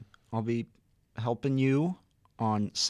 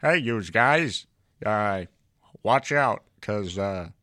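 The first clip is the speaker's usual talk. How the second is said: very loud, slow character voice; put-on catchphrase voice; 9 dB louder than usual. Actor saying the film line, booming put-on voice. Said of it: "You tried talking in that voice".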